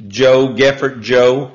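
ordinary delivery

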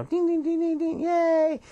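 very high, piping ding-ding and cheer; imitated ringing bells. Host ringing bells for the state with most listens, high-pitched mock celebration. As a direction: mimic little bells, then a mock cheer. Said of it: "you guys can ring your bells".

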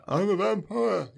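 high, slow, hammed-up vampire voice; mock vampire. Podcaster mocking Cage's over-the-top vampire performance, hammed-up. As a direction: ham up a crazed vampire line.

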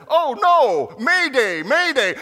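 high, loud distress call; panicked captain's mayday. Acted. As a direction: cry mayday like a captain going down. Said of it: "then from the captain".

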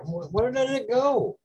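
high, puzzled searching question; confused. Retold or imitated. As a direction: sound lost and puzzled. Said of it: "I couldn't find it".

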